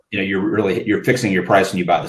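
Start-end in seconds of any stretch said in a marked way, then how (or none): none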